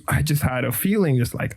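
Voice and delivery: ordinary talk, nothing marked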